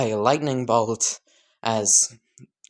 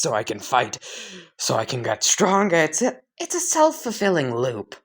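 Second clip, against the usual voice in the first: eager fighter; 5 semitones higher than usual. Acted. Akaza explaining eagerly.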